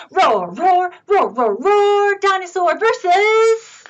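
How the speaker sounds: lifted, punchy play roars; playful contest-caller energy; roaring dinosaur. Acted. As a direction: roar into a big match-up announcement.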